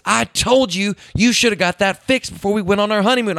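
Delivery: high-pitched mock wife's scolding; Lisa. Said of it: "And Lisa just".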